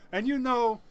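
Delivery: a light send-up of a politician on stage; Donald Trump. Retold or imitated. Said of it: "he said".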